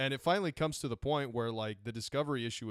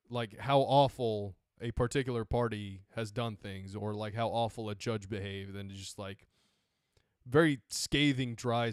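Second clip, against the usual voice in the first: ordinary talk, nothing marked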